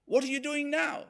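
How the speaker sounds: high, retold yelp of protest; mock scream. Pricked customer challenging the barber, mock screaming. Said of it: "howls and screams at him".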